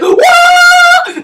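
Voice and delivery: very high, loud mock scream; long put-on shriek; burning scream. Girl catching fire in his hug, high loud scream.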